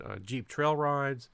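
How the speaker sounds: normal talking voice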